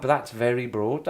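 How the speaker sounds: ordinary talk, nothing marked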